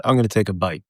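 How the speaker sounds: ordinary delivery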